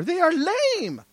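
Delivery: high, drawn-out "lame"; vernacular put-down. Acted. Preacher mimicking slang for his congregation, drawn-out put-down. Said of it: "even in our vernacular".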